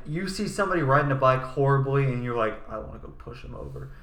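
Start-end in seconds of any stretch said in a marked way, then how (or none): 2.7-3.9 whispered thought. Retold or imitated. Voicing a violent passing thought.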